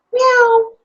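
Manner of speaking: high, loud playful meow of a kitty; kitten meow. Acted. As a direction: squeak out a little cat's meow. Cat found under the bed.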